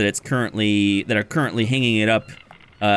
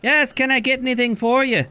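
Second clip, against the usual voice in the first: much higher voice, eye-rolling; bored barkeep; 12 semitones higher than usual. Acted. Water genasi barkeep rolls her eyes at customers, high bored voice. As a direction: sigh it out like a weary barkeep. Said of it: "the barkeep rolls her eyes and says".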